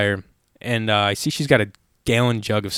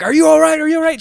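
high, loud, alarmed calling out; worried shout; 4 dB louder, faster than usual. Retold or imitated. Calling from the car to the burning woman, alarmed shout. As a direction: shout across to her in alarm.

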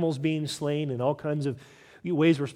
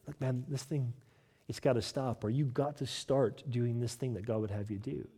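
much softer, low and confidential; quiet private rebuke; 6 semitones lower, 9 dB quieter than usual. Acted. Preacher voicing a private word to a brother, hushed and low. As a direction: take him aside and say it gently, almost under your breath.

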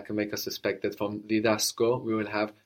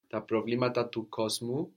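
normal talking voice

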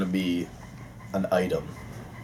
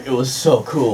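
ordinary talk, nothing marked

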